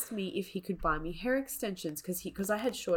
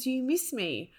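higher, sing-song mock of "Do you miss me?"; mocking his text; 6 semitones higher than usual. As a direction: parody the clingy text, pitched up.

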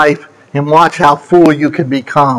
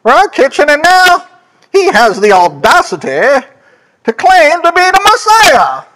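higher and higher, indignant disbelief; incredulous townsfolk; 6 semitones higher than usual. Retold or imitated. Preacher imitating doubters who knew Jesus as carpenter, scoffing. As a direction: voice the neighbours who can't believe it. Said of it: "They might be thinking".

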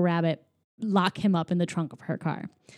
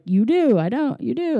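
bickering back and forth; 4 semitones higher than usual. Retold or imitated. Acted back-and-forth cartoon argument.

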